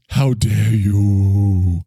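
very slow, stretched mock indignation; hammed-up scolding line; Greta Thunberg voice. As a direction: draw out every word in parody. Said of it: "Is this all she says?".

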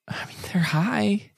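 breathy, high whisper. Real, not acted. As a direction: say it under your breath. Unvoiced aside.

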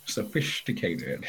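low, soft, slow delivery; deadpan punchline. Acted. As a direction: drop it dry and quiet.

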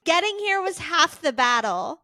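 high, loud peppy instructor line; fitness instructor voice. Pretend. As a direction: cheer the class on. Continues instructor bit.

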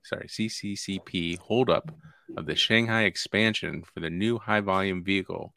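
normal talking voice

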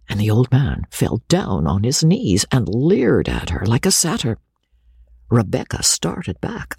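usual speaking voice

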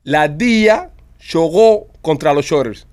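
slow test sentence with gaps; slow dictation. Shown as a demonstration. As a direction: dictate it slowly, phrase by phrase. (dictado lento, por partes)

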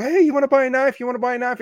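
pushy, hyper knife seller pouncing on shoppers; too excited. Retold or imitated. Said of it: "too excited energy".

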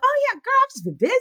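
high, breezy fake brightness; bright cover-up with a high start; faking cheer. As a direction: pretend everything's fine. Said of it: "fake the funk".

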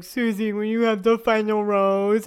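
slow, drawn-out rose offer; hammed-up, slow bachelor voice; mock ceremonial proposal. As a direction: drag it out like a sappy suitor. Mocking Clayton's rose offer.

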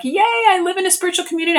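high, fast, giddy yay; high-pitched gushing yay, rushed; mock excitement. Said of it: "I was like".

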